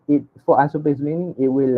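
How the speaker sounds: ordinary talk, nothing marked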